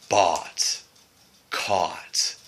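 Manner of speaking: "bought" and "caught" with a longer ah vowel; lengthened ah. Shown as a demonstration. Vlogger imitating how some Canadians say it. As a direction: stretch the ah vowel. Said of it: "They make it longer".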